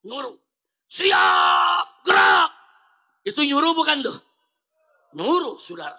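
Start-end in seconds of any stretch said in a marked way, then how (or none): 0.9-2.5 barked drill command, loud and high; commander's shout. Retold or imitated. General ordering soldiers on the field, loud shout. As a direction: yell it across the parade ground. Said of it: "komandan teriak".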